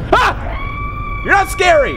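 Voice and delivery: high loud scream then taunt; scream, then yell defiance; defiant scream. Real, not acted. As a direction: shout down the monster.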